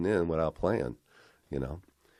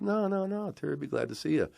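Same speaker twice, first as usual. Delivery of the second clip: eager reassurance; 11 semitones higher than usual. Retold or imitated. Retold friend reassures him eagerly.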